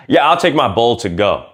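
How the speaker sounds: ordinary talk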